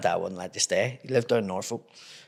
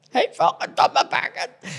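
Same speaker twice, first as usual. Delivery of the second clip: high, strained imitation of an injured mate; mock pained cry; 11 semitones higher, 4 dB louder than usual. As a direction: cry out in exaggerated pain. Acts out Pee Wee hurting his back.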